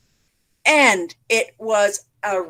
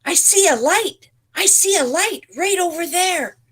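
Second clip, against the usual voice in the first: excited higher call from up the tree; 9 semitones higher than usual. Acted. Rooster calls to his friends from up high. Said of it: "Rooster called out".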